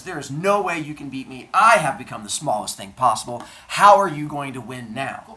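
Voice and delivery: loud, triumphant taunt. Acted. Metis as a gnat taunting Zeus, loud triumphant voice. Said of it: "And she says".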